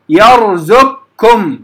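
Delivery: يرزقكم with ق fully merged into a doubled, light ك; thin doubled k in يرزقكم, no trace of qaf; merged qaf into kaf. Shown as a demonstration. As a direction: slowly recite يرزقكم as yarzukkum. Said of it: "فتقرأ يرزقكم".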